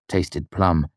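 plain reading voice

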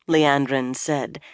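usual speaking voice

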